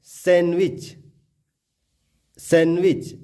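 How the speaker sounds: सैंडविच said as "san-wich"; सैंडविच with n running into w; silent D. Shown as a demonstration. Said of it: "सैंडविच ना बोल के".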